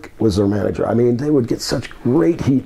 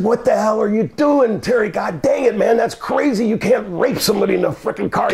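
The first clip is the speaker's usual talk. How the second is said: retold scolding; 7 semitones higher than usual. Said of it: "And I said".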